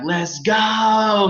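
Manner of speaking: high, loud hype shout; screaming. Retold or imitated. Upperclassman finishing their long handshake, screaming let's go. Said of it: "He'd be, like, screaming".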